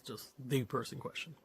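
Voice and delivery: ordinary delivery, nothing marked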